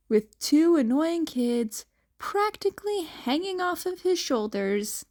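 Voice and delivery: ordinary delivery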